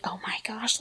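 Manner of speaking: breathless whisper of alarm; horrified whisper. Retold or imitated. Mom to the 911 dispatcher, fearing the worst, whispered. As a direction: hush it in sudden dread.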